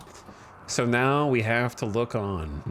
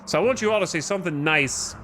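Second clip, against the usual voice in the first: put-on Peaky Blinders accent. Colony leader scolds colonists at the graveside, in a Birmingham accent. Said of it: "I don't know why I just went into that accent".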